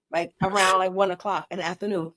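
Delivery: ordinary talk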